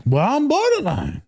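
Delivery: drawn-out, high-pitched Southern drawl; mock Southern belle accent. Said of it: "she got a Southern accent".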